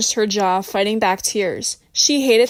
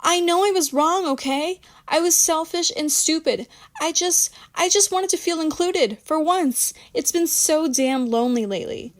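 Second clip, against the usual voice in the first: higher-pitched, small defeated voice; 7 semitones higher than usual. A narrator's reading. Rebecca admits she was wrong to Michelle, small and defeated. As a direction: plead in a small, defeated murmur. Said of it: "her voice small and defeated".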